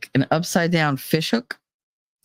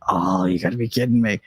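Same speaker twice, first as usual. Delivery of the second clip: low, fed-up groan; exasperated groan; 9 semitones lower than usual. Retold or imitated. Told she needs Norplant, answering the doctor, exasperated. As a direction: drop into a deadpan groan.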